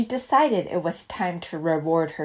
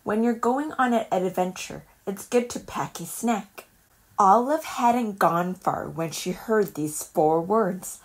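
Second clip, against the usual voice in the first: ordinary delivery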